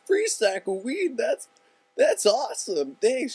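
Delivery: high, loud, slowed-down delight; thrilled disbelief. Retold or imitated. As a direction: savor each word, overjoyed. Offered free weed.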